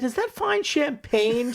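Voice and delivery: slow, high-pitched guess; hammed-up hopeful teacher; excited teacher. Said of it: "teacher says".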